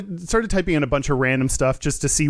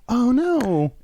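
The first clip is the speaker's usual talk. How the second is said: drawn-out, higher dismayed "oh no"; overly concerned; 7 semitones higher than usual. Real, not acted. Reacting to the host leaving the show, overly concerned. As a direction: sound more upset than you really care. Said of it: "as much as I just sounded like I did".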